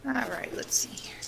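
soft unvoiced whisper; hushed whisper to the game. Real, not acted. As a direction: murmur to yourself while playing. Whispering while playing.

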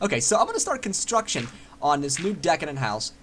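ordinary delivery

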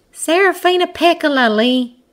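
high-pitched smug correction; admonishing, slightly smug. A narrator's reading. Hare corrects the man's mispronunciation, admonishing and smug. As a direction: correct him, pleased to know better. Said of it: "voice both admonishing and slightly smug".

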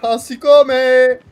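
slow, drawn-out shouted incantation; spell incantation. Pretend. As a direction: cast it like a wizard spell.

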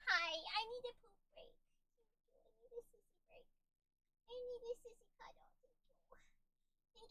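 high cooing pet voice. Real, not acted. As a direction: coo at the puppy. Baby talk to her pet dog.

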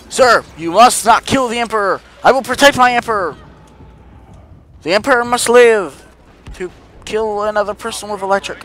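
solemn loyal guard; Emperor's guard. Pretend.

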